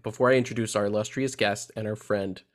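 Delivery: ordinary talk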